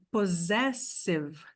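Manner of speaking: possessive slowed, first SS as Z, second as S; slow English demo. Shown as a demonstration. Teacher breaking down possessive for students. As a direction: say possessive slowly, Z then S. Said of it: "primeiro parzinho de S tem som de Z".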